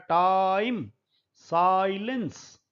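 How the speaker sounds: टाइम and साइलेंस said with the same ai, साइलेंस slow; match the ai in टाइम and साइलेंस; same vowel demo. Shown as a demonstration. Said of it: "दोनों को same बोला जा रहा है". (टाइम और साइलेंस में एक जैसा आई)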